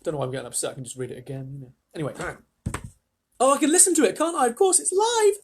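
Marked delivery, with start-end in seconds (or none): 3.4-5.4 loud, very high squeal; high-pitched silly outburst. Pretend. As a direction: squeal with hammed-up emotion. Reader joking, mock excitement.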